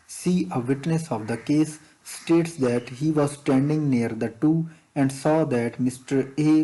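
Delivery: slow, deliberate dictation pace; teacher reading an exam question slowly. Shown as a demonstration. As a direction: read it out word by word for students to follow. Reads exam question text aloud.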